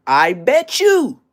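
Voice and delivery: loud slow warning. Acted. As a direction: shout it slow and high.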